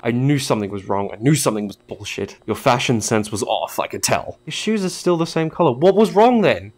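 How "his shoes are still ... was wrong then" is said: exasperated question. Real, not acted. Gamer can't find the anomaly, loud baffled wail.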